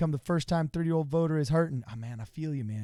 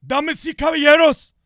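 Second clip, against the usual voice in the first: Bernie Sanders impression; 11 semitones higher, 8 dB louder than usual. Impersonating Bernie Sanders giving a Spanish stump speech, loud and high. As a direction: rant like Bernie at a rally, in Spanish. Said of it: "this is how Bernie Sanders would speak in Spanish".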